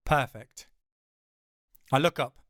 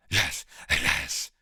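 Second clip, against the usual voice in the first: low breathy whisper; 11 semitones lower than usual. Acted. Swordsman's companion whispers urgently, low and unvoiced. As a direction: hiss it out eagerly. Voice answering in story.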